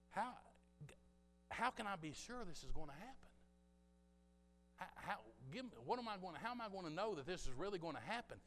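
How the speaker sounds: a hint of an unsure old priest; doubting Zacharias. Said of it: "he's saying pretty much this".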